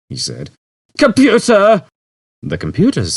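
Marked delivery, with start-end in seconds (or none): 1.0-1.8 high, loud call; summoning call. A narrator's reading. Zaphod summoning the computer, loud call. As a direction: bark for the computer.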